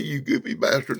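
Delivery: ordinary delivery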